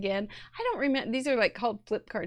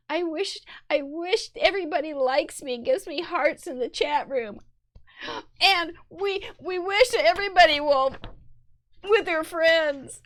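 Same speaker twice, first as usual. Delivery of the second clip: high, sweet little animal wishing voice, getting louder; cute critter voice; 5 semitones higher, 4 dB louder than usual. Pretend. Voicing critters making a wish.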